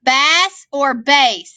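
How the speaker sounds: first bass short like "mass", then bass long like "base"; bass both ways. Shown as a demonstration. Teacher quizzing the class on which bass it is, slow. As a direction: slowly set bass against bass. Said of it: "There's a long A there".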